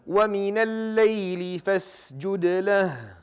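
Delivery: slow, melodic tajweed chanting with long held vowels; Quran recitation. Acted. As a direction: recite each verse in a drawn-out chanted melody.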